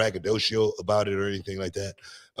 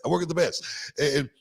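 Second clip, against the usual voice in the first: ordinary delivery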